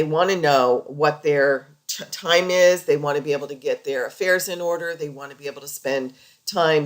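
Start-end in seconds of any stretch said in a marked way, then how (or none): none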